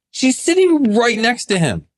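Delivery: astonished. Real, not acted. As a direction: still shocked he said it in front of her. Disbelief at client's words.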